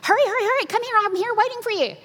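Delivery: high, loud, hurried urging; urgent call. Retold or imitated. Monk calling to the visitor at the monastery door, urgent and hurried. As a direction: rush her in, urgently.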